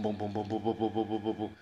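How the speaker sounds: rhythmic booms mimicking a racing heart; booming heartbeat sounds; heartbeat imitation. Shown as a demonstration. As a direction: pound out each boom like a heartbeat. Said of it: "My heart is again boom".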